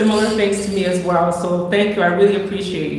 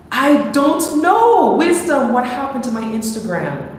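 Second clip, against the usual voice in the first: distressed wail; 7 semitones higher, 3 dB louder than usual. Real, not acted. Her account just got suspended.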